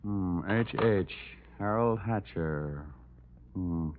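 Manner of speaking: slow, thoughtful mumble to oneself; mumbling. Acted. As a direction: mull a name over slowly, half aloud. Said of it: "What are you mumbling about?".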